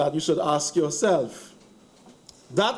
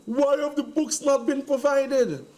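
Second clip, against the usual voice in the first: higher-pitched mock sanctimony; sanctimonious; 7 semitones higher than usual. MP mimicking the member's question, mock sanctimonious. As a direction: mimic a pious, indignant question. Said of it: "in very sanctimonious terms".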